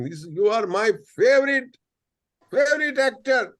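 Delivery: high, loud starstruck gush; fan shouting. Retold or imitated. Fan on a flight shouting at the actor, high and loud. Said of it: "he started shouting".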